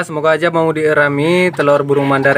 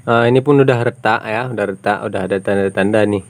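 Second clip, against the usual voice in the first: ordinary delivery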